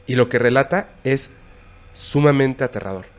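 ordinary talk, nothing marked

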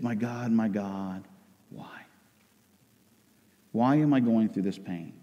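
low, soft, halting anguish; quiet lament. Acted. Sufferer asking God about their pain, subdued anguish. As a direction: ask God softly, with long pauses.

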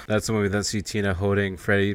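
ordinary talk, nothing marked

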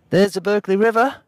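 high, put-on accent, calling out; Dave. A narrator's reading. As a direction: call it out in an exaggerated character accent. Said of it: "for Dave for his accent".

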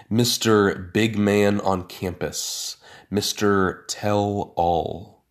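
ordinary delivery, nothing marked

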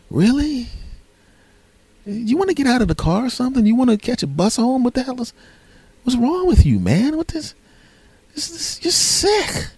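very high, squeaky incredulous scolding; appalled, halting, hammed-up squeal; mock disgust. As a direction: falsetto outrage at a friend gone too far. Appalled friends scold the guy.

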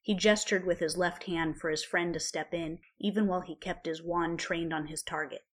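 ordinary delivery

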